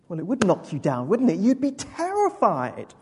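usual speaking voice

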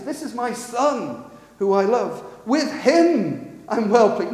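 "my son" and "him" stretched and punched; ringing stress on "him", drawn out; emphatic. Shown as a demonstration. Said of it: "Now listen to the emphasis".

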